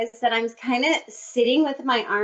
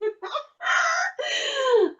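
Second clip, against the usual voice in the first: a high burst of laughter at herself. Real, not acted. Laughs at own look.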